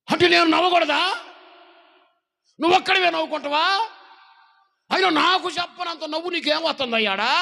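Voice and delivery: high, loud demanding wife's voice; nagging wife. Acted. Wife demands her husband explain his laughter, loud and high. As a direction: press him hard, voice pitched high.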